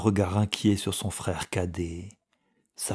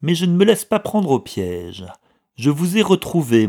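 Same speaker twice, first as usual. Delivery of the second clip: raised, louder taunting voice; mocking; 7 semitones higher, 7 dB louder than usual. A narrator's reading. Brother who has found the clerk, mocking. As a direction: taunt him triumphantly. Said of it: "d'un ton narquois".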